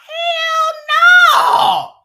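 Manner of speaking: high, loud "hell no"; shouted refusal. Retold or imitated. Friends answer the livestream with a high loud shout. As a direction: blast it back at her. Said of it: "We was like".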